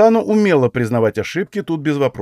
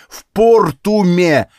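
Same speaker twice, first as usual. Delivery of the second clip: slow, loud, higher hammered-out repeat; exploding; 7 semitones higher, 6 dB louder, much slower than usual. A narrator's reading. Hero drives the place name home to the woman, exploding slowly. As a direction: stretch ПОРТУМЕ out angrily, word by word. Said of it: "взорвался я".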